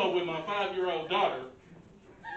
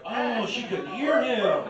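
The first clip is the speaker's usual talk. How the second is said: laughing realization; 2 semitones higher, 4 dB louder, slower than usual. Retold or imitated.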